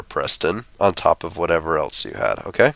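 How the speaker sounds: ordinary delivery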